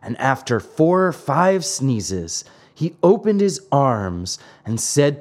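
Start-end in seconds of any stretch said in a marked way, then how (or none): none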